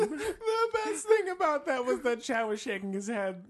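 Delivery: high, helpless laughing speech. Real, not acted. Friend describing Chad shaking his head no, laughing. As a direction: talk through real laughter. Cracking up at co-host's vow.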